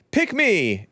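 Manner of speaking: high eager mocking cry; mocking Vivek. Host mocking a candidate as the eager pick me kid. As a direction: plead like a kid begging to be chosen. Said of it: "That's Vivek".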